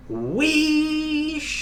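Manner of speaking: high hissing wheesh of escaping steam; a sudden whoosh of engine steam. A narrator's reading. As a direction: let the steam burst out in a high hiss. James lets off steam.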